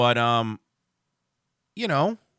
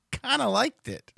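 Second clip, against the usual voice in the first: sheepish admission; 8 semitones higher than usual. Real, not acted. Confessing unpopular opinion.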